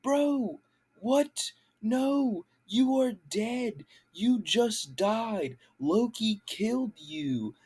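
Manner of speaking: incredulous scolding. Retold or imitated. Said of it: "And it's like".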